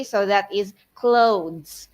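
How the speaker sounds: clothes said slowly, the TH sounding like D; careful clothes. Shown as a demonstration. Modeling clothes for teachers, slow and careful. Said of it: "would sound like D".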